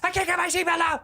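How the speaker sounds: panicked shout. Acted. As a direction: yell trapped in the seatbelt. Panic in burning car.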